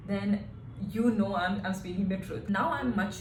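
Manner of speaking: usual speaking voice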